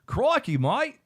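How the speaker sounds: high, loud Australian exclamation; Steve Irwin impression. Channelling a TV croc hunter on request, high loud Australian impression. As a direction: cry it out big and high like an Australian TV naturalist. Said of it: "Channel your Steve Irwin".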